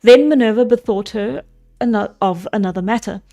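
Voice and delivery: steady storybook reading voice; story narration. A narrator's reading. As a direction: read it like an old epic tale. Reads the Odyssey aloud.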